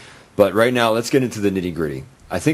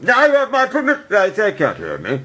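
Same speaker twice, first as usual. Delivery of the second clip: high strained mask yell; yelling through a mask; 13 semitones higher than usual. Retold or imitated. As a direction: shout to be heard through a mask. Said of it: "he's wearing a mask".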